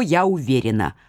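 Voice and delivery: plain reading voice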